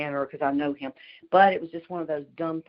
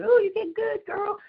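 high squealing cheer, far above her usual voice; cheering crowd; 4 dB louder, slower than usual. Retold or imitated. Friends cheering her on after she qualifies, high squeal. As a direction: squeal it like a fan at the range. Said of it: "see everybody jumping up and down, saying".

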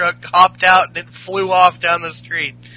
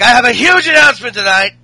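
loud, raised ring announcement; wrestler's promo, halting but punched up; retold wrestling announcement; 4 semitones higher, 6 dB louder than usual. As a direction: lay down a challenge to the crowd. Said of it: "he's like".

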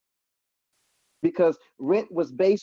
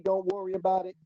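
ordinary talk, nothing marked

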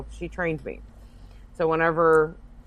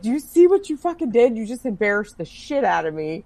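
mock indignant scolding; 6 semitones higher than usual. Acted scolding of embarrassing friend.

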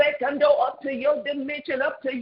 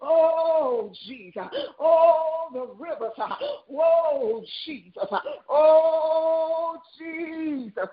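fervent prayer cries; 5 semitones higher, slower than usual. Real, not acted. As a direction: cry out in earnest prayer.

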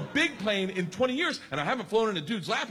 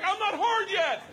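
high, hollered stalling; panicked protest; 11 semitones higher, slower than usual. Retold or imitated. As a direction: yell it in comic desperation.

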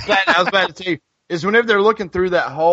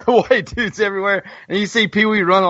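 normal talking voice

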